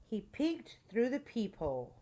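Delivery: plain reading voice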